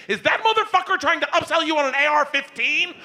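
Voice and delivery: high-pitched indignant screech; outraged wife. Retold or imitated. Wife confronting husband over upsell, outraged screech. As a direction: mimic a wife storming in furious.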